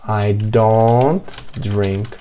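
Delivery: very slow, deliberate full sentence; teacher modelling a complete reply, slow; slow full answer. Shown as a demonstration. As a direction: spell out the full answer slowly. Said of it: "complementar ela dessa forma".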